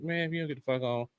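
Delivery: ordinary talk, nothing marked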